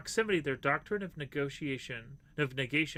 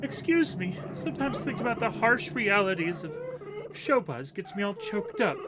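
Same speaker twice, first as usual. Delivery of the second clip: higher, hammed-up choked-up sniffle; mock weepy villain; 8 semitones higher, 6 dB louder than usual. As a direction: play a sad killer who fakes tears. Voices weepy Weepster character.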